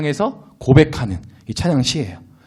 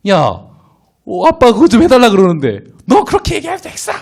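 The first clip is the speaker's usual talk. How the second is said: high-pitched indignant dad, acted out; parent scolding back; 8 semitones higher, 3 dB louder than usual. Father answering his snappy child in a quarrel, acted indignant scolding. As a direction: flare up in mock parental outrage. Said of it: "싸움이 나죠".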